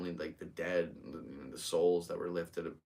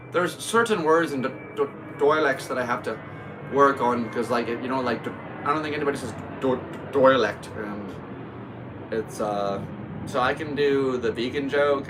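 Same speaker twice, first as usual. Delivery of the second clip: loud, higher-pitched fake Irish brogue; put-on Irish accent; 6 semitones higher, 9 dB louder than usual. Shown as a demonstration. Comedian practising dialect words for a joke, put-on Irish accent. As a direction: lean hard into a stage Irish accent. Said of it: "Irish accent".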